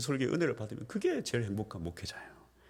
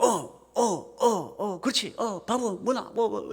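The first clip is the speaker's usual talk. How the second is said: a hint of Gyeongsang phone grunts, higher; Gyeongsang phone chat; 9 semitones higher, much slower than usual. Friend answering the phone in Gyeongsang dialect, lightly voiced. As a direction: toss off short Gyeongsang phone replies. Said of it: "친구가 전화하면".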